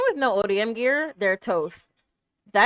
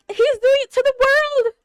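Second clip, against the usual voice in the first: shocked cry; 13 semitones higher, 4 dB louder than usual. Real, not acted. Shock at the plot.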